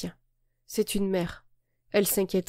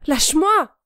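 high, louder shout; yelled; 8 semitones higher, 7 dB louder, slower than usual. Acted. Girl to the boy squeezing her wrist, a pained yell. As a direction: yell at him to let go. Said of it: "hurlai-je".

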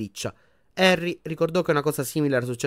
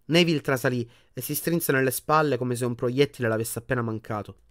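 plain reading voice

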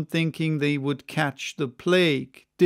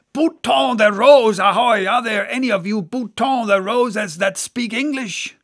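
"Bouton de Rose" bawled loud and high with an English r; bawling; 6 semitones higher, 7 dB louder than usual. Acted. Stubb hails the French ship, bawling. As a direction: bellow it across the water. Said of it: "he bawled".

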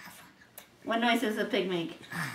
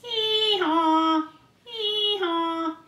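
high, long sing-song hee-haw; donkey bray; 9 semitones higher, much slower than usual. Acted. Parent showing what a donkey says, high drawn-out braying. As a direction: bray high, holding each syllable. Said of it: "What does a donkey say?".

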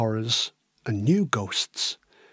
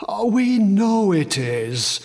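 sighing; 5 semitones higher, slower than usual. A narrator's reading. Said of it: "the Major sighed".

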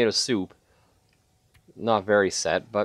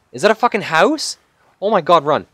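raised, urgent outburst; excited disbelief; 5 semitones higher, 6 dB louder than usual. Real, not acted. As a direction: blurt it high and loud on spotting rescue. Spots a house while freezing.

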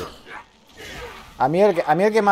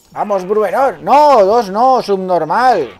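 loud, high, drawn-out yelling; exasperated shout; 6 semitones higher, 7 dB louder, much slower than usual. Real, not acted. Player yelling at his fighter mid-battle, exasperated shout. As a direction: yell it in real frustration at the game.

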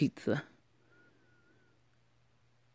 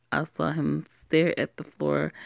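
ordinary delivery

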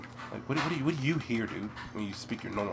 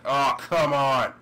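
loud, drawn-out protest; exasperated shout; 3 semitones higher, 9 dB louder, much slower than usual. Real, not acted. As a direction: slowly yell it at the game. Game goes wrong.

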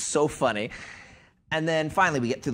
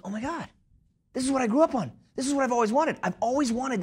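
higher, marveling retold thought; awed realization; 10 semitones higher, faster than usual. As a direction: act out a dream coming true.

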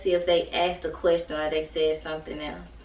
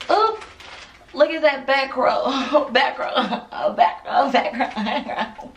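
laughing; 5 semitones higher, 5 dB louder than usual. Real, not acted. Laughing at her hair.

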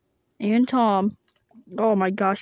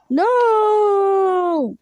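loud no; 9 semitones higher, 6 dB louder than usual. Acted. Character protests loudly.

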